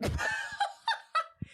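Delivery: high burst of real laughter. Real, not acted. Streamer to viewers saying it sounds the same, high laughter.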